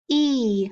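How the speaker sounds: "E" said alone as a long ee; isolated vowel sound. Shown as a demonstration. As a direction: stretch out "E" for the class. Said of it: "Digraph E Y".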